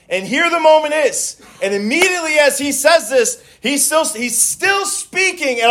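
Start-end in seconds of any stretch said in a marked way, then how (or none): none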